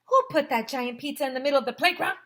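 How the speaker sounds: fast, loud indignant question; angry demand. Acted. Mister Klutz covered in sauce, angry fast demand. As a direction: demand an answer while wiping off sauce. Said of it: "asked Mister Klutz".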